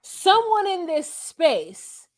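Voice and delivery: drawn-out, high, pointed accusation; slow accusing emphasis. Retold or imitated. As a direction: lean on every word as if naming a liar. Marshall accusing the house.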